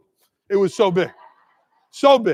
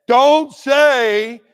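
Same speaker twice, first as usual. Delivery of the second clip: ordinary talk, nothing marked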